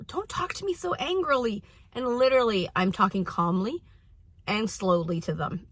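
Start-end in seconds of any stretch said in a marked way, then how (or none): 0.1-1.6 put-on high, soft childlike complaint; imitating a child. Child objecting to mother's tone, high mock complaint. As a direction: mimic a kid protesting, pitched up. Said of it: "One of my children".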